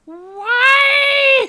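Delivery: very high, loud drawn-out shriek; anguished wail. Real, not acted.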